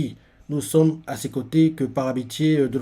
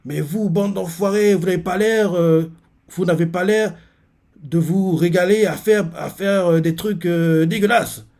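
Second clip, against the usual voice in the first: Shin insulting; 5 semitones higher, 4 dB louder than usual. Retold or imitated. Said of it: "il continue en disant".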